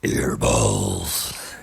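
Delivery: low, goofy put-on voice; deep silly voice. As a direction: drop way down for a goofy voice. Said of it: "I like doing that voice".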